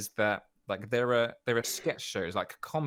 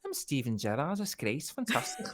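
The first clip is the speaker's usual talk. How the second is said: high, hammed-up Liverpool accent; playful over-the-top Scouse impression; 9 semitones higher than usual. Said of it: "a Scouser sounds like this".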